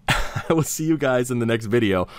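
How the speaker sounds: amused, laughing sign-off. Real, not acted. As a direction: redo the outro through a laugh. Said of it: "because I messed up the outro".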